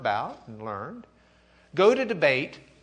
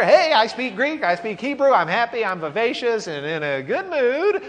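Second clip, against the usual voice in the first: much higher, louder, bouncy boast; cheery Luther; 9 semitones higher, 7 dB louder than usual. Pretend. Teacher voicing Luther bragging cheerfully to Eck, high and bright. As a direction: brag like a happy scholar.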